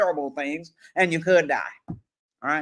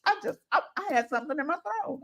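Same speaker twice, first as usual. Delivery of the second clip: put-on child's excuse voice; 8 semitones higher, 7 dB quieter, faster than usual.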